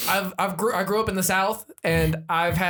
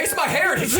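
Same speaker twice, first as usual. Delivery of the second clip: mock proud heritage voice; 11 semitones higher than usual. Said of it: "my great-grandfather was in the Ku Klux Klan".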